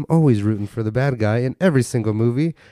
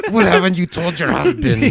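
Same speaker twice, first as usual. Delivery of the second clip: mock interrogator; 7 semitones higher than usual.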